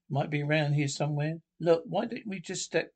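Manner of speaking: usual speaking voice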